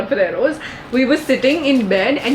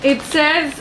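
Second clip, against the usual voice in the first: ordinary talk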